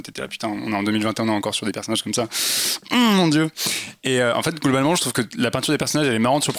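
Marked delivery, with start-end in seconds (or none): 2.9-3.5 exasperated sigh. Real, not acted. Exasperated at dated characters.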